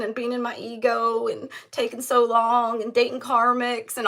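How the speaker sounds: teasing impression of a partner coming back to apologize; sheepish returning lover. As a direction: play the apologetic partner showing up with flowers, hammed up. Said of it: "He's like".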